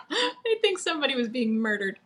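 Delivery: laughing through the line. Real, not acted. Host laughing at the pig joke, laughing. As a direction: giggle the words out. Joking about squealing pigs.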